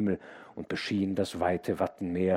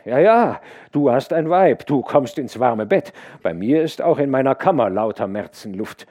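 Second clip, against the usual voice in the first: louder, higher mocking farmhand; teasing servant; 5 semitones higher, 11 dB louder than usual. A narrator's reading. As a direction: needle him about his warm bed. Servant teases the labourer.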